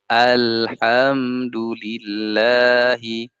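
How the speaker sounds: "Alhamdulillahi" very slow, smoothly connected; correct flowing recitation. Shown as a demonstration. Teacher models bainiyah, "Alhamdulillahi" slow and drawn out. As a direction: recite "Alhamdulillahi" slowly with lingering l and m.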